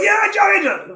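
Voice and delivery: mock crowd cheer, high and loud. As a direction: yell it like a hyped arena. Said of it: "everyone was like".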